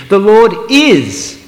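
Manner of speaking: slow, high and loud, "is" landing with a firm fall; emphatic, proclaiming "is"; stress on IS. Shown as a demonstration. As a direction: declare "The Lord is" slowly, full force. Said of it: "I'm gonna emphasise just one at a time".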